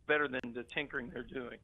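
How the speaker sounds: ordinary delivery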